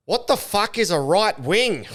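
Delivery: put-on high, loud, slow drunk heckler bellow; mocking heckler. Comic mimics heckler yelling at Amos, loud and high. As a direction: ham up a clueless heckler shouting at the comic. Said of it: "He literally goes".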